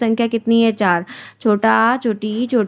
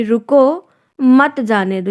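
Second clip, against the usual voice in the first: रुको held up, a break, then मत जाने दो; pause after रुको; much slower than usual. Shown as a demonstration. Teacher reading the comma example, pause after रुको. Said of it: "यहाँ पे अल्प विराम का प्रयोग है".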